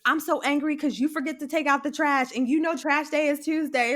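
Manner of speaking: hammed-up complaint to a partner about trash day; mock angry outburst. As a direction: blow up at your partner, half acting it out.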